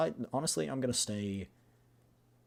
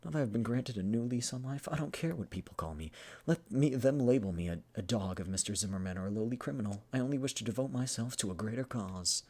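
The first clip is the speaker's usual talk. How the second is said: lightly acted earnest monologue; heartfelt game-character speech; earnest, devoted man; 5 semitones higher, 5 dB louder than usual. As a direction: play a grateful man declaring his devotion. Voices Carl's speech about his past.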